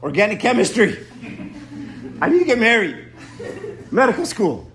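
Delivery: mock shouted demands; barked prayers. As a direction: bark requests at God like orders. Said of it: "we bark something".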